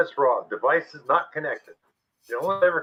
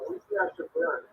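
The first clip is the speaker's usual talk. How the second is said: mock echo; 7 dB quieter than usual. Mocking echo of the phrase.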